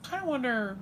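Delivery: high, prissy catty aside. Acted. Comedian judges pageant women, high catty prissy aside. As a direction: dish it like a snob.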